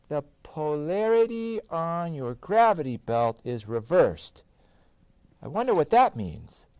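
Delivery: lightly voiced card reading. A narrator's reading. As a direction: read the card in a slightly odd voice. Card voice read by narrator.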